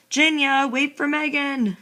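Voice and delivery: high, loud, drawn-out call; dad's yell. A narrator's reading. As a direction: holler to a child far ahead. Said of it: "Dad yells".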